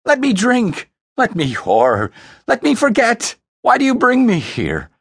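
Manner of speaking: high, loud desperate wail; anguished, high-pitched outburst; voice cracking. Acted. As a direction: plead and protest at a breaking pitch. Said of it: "His voice cracked".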